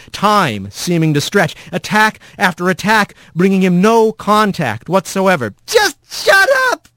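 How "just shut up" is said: high-pitched, exasperated yell. A narrator's reading. Rat attacks the voice without contact, high exasperated yell. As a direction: yell each word out high. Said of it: "he heard himself yelling over and over".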